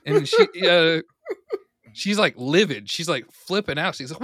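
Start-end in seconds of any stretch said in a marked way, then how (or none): none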